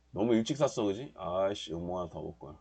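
normal talking voice

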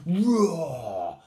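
long, high, falling lion roar; loud roar. A narrator's reading. Brothers and sisters showing Little Lion, loud roar. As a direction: stretch the word into a big roar. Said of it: "waking up and roaring loudly".

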